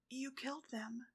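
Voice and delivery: faint, hushed accusation; almost too quiet to hear; barely audible. A narrator's reading. Ladybug accusing Cat Blanc amid flames, barely audible. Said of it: "Ladybug's voice could barely be heard".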